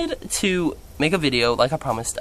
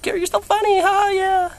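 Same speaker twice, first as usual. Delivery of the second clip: much higher squeaky voice imitating his friends; mock girly friends; 3 dB louder than usual. Imitating friends praising him, in a high squeaky voice. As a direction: play the giggly friends cooing at him.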